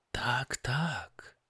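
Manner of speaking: a dry, mocking yes to her husband; ironic agreement. Wife mocks her cheerful husband, ironic. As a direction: agree with him but mean the opposite. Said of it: "zawołała żona z ironią".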